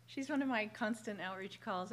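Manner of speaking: ordinary delivery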